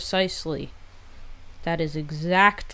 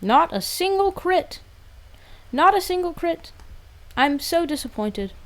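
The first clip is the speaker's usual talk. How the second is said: mock outrage; 9 semitones higher than usual. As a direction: overact the indignation.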